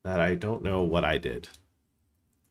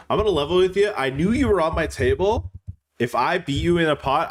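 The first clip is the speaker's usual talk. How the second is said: Arkansas accent; 8 semitones higher than usual. Shown as a demonstration. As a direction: put on a hammy Arkansas accent. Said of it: "Pat, do an Arkansas accent".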